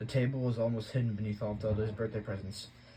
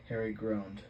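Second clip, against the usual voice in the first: ordinary delivery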